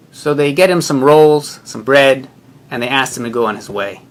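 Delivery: normal talking voice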